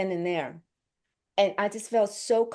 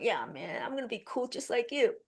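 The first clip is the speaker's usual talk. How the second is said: playing it cool; 4 semitones higher, 9 dB quieter than usual. Nervous actress faking calm meeting Tom Hardy, soft mock cool. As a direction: fake nonchalance to hide nerves. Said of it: "Miss Cool".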